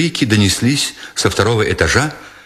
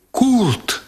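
much higher, anguished cry of a name; sobbing cry; 12 semitones higher than usual. A narrator's reading. German girl seeing Kurt caught below, sobbing cry. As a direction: cry out the name through tears. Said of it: "рыдающе вскрикнула немка".

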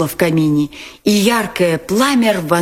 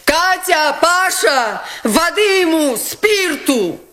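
much higher urgent calling out; commanding call; 12 semitones higher, slower than usual. Acted. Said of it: "крикнула Настасья Филипповна". (крикнула, приказывая)

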